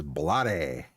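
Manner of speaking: "blade" in two syllables, blah-day; alternative pronunciation. Shown as a demonstration. Streamer showing how to pronounce it, "blade" as blah-day. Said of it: "I could pronounce it as".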